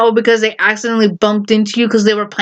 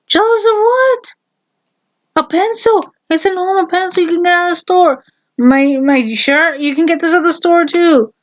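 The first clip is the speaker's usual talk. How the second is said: high, scornful disbelief; incredulous mockery; 8 semitones higher than usual. Real, not acted. Mocking her mom's jealousy excuse, high and scornful. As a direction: scoff at the idea, way up high.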